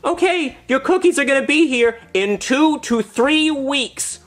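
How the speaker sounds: put-on high little-girl voice; squeaky mock kid voice; Girl Scout. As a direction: pipe it out like a child seller. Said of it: "the Girl Scout says".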